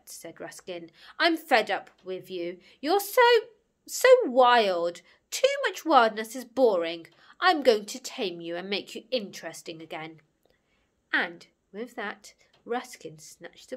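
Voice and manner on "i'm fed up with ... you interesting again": loud, exasperated telling-off; fed-up scolding. Acted. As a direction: stand up to the bully loudly. Ruskin scolds the bully, fed up.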